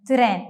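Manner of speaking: "തിറേൻ" with a short vowel between t and r; Turkish pronunciation. Shown as a demonstration. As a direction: say "തിറേൻ" as two syllables, tı-ren. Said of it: "എന്നാണ് പറയുന്നത്".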